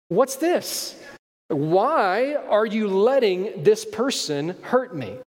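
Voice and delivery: put-on high, slow, wounded child's question; child's hurt plea. Kid at the doctor asking parent why, hurt and pleading. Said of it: "they're like".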